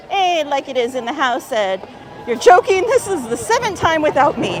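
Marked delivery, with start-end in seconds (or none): 2.3-4.6 high, loud mock outrage. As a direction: shout it as if betrayed. Reading an outraged chat message.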